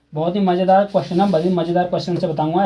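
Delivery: ordinary talk, nothing marked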